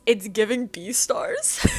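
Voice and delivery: loud read-out about to crack up; amused outburst. Real, not acted. Reader reading out her own note, amused outburst.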